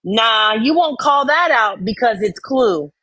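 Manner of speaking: usual speaking voice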